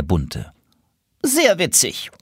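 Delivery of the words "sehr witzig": mild annoyed pigeon, much higher; a touch of irritation, pitched up. A narrator's reading. Pigeon annoyed by the joke, mildly irritated. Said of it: "sagte der Graublaue verärgert".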